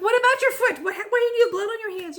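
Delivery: put-on high, loud alarmed shouting at her child; panicked yell. To her daughter with bloody hands, high panicked yell.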